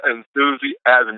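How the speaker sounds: Enthusiasm stretched very slowly, every syllable held; drawn-out key word. Shown as a demonstration. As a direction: linger on each syllable of Enthusiasm to make it stick. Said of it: "the key word this week is enthusiasm".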